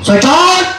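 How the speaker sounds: dramatic punchy hero lines. Acted. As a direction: belt out the name like a film hero. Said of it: "camera, action".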